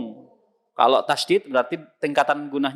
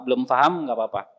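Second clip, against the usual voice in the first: ordinary talk, nothing marked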